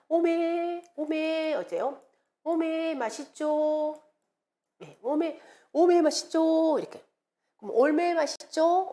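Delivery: spielerisch hoher Jeolla-Dialekt-Ausruf „오메 맛있죠“; Jeolla-Dialekt locker und neckend nachmachen. Retold or imitated. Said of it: "오메 막 이런 말 쓰죠".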